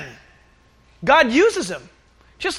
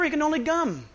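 normal talking voice

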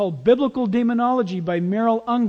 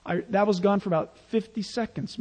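ordinary delivery

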